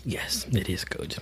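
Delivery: hushed whisper. Real, not acted. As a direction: breathe it out, voiceless. Whispered reply, then repeated.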